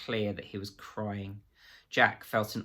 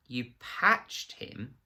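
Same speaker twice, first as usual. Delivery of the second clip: slow, drawn-out incredulous question; disbelieving; 3 semitones higher, much slower than usual. A narrator's reading. Reeves doubting Absalom, slow and incredulous. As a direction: stretch it out in disbelief.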